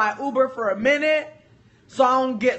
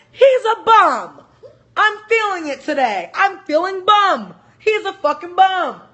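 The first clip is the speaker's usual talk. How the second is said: loud mocking insult; 7 semitones higher, 5 dB louder than usual. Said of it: "But I was like".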